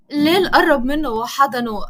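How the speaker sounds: ordinary delivery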